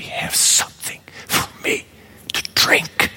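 parched, barely voiced whisper of a dying man; faint whisper. Preacher voicing the thirsty Jesus on the cross, a faint whisper. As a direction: breathe it out dry and weak, almost no voice.

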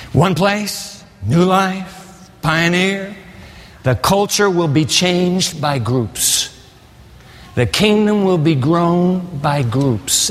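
slow, weighted preaching lines; slow emphatic declaration. Real, not acted. Preacher proclaiming to congregation, slow and weighty. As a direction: lay each word down deliberately. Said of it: "preacher's slow emphatic declarations".

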